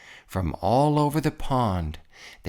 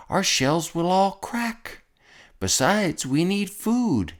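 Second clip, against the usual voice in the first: higher, pleading aching voice; a light groan of a crushed turtle; 7 semitones higher than usual. A narrator's reading. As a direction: Mac begging the king from below. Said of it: "groaned Mac".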